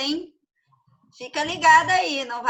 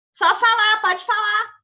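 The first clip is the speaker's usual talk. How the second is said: raised voice over bad audio; loud and high, calling across; 7 semitones higher, 5 dB louder than usual. Real, not acted.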